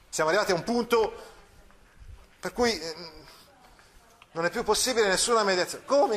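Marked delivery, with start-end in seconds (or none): 5.8-6.1 indignant come. Acted. Don Rodrigo startled, indignant.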